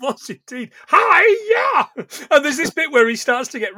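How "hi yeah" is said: high, loud karate-chop squeal; Miss Piggy. Retold or imitated. Podcaster doing the puppet pig's squealing chop. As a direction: shriek it like a pig puppet mid-chop. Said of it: "he just sounds like Miss Piggy".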